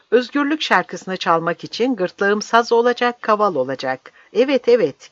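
fervent, high-pitched declaration; impassioned defiance. Acted. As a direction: proclaim it fearlessly, like a last speech. Old man challenges his executioners.

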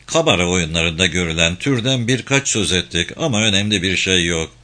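ordinary delivery, nothing marked

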